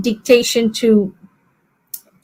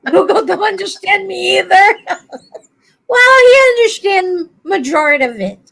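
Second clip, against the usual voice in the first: laughing complaint; 8 semitones higher than usual. Real, not acted. Joking about Google.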